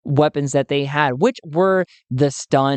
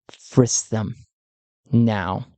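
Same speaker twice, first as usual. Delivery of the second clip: lower, clipped-slow command; low cold order; 5 semitones lower, much slower than usual. Acted. Emelina orders the secretary.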